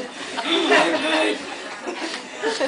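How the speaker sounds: high, loud laughter; real laugh. Real, not acted. Speaker laughing at her sons' retort, high and loud. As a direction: laugh freely at your own story.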